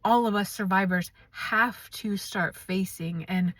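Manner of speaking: ordinary delivery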